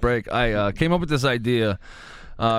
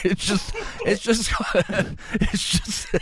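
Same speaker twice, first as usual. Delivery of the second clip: laughing; 5 semitones higher, slower than usual. Real, not acted. Cracking up at the joke.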